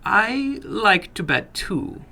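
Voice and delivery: slow, deliberate little man; the little man tempts the boy, slowly. A narrator's reading. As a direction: draw each word out, savoring it. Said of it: "said slowly".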